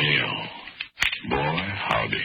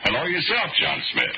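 cheery high greeting; 13 semitones higher than usual. Acted. Greeting the old man again.

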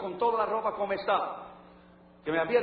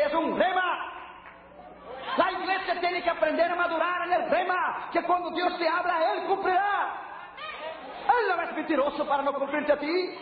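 full-force preaching; 6 semitones higher than usual. Real, not acted. Preacher urging the church to trust God's word, raised and fervent. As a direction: press every word on the congregation.

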